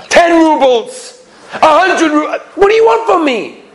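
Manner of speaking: high, exasperated outburst; acted frantic bargainer, voice pitched high; desperate haggling. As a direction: throw out offers in mounting desperation. Desperate husband raising his offer.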